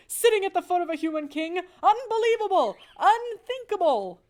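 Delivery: very high, loud outraged yell; furious father mouse shouting. Acted. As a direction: bellow it in horror. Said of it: "he shouted".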